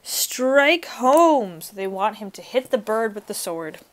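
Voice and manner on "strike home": loud urging cry, pitched up; louder narrated crowd yell; crowd scream. A narrator's reading. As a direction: call out like the crowd egging him on. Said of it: "screamed the crowd".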